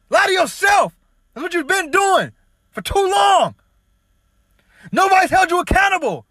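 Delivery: high, loud, raging yell; going off at full volume; furious ranting shout. Real, not acted. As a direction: scream it at them, out of control. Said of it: "going off on these wild tangents and snapping".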